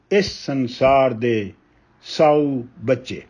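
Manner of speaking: measured, weighty reading of a Punjabi poem; ਕਵਿਤਾ ਪਾਠ ਦੀ ਠਹਿਰਾਵ ਵਾਲੀ ਅਵਾਜ਼; poem recitation. Acted. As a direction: recite each line slowly, letting pauses breathe. Poet recites poem Jog.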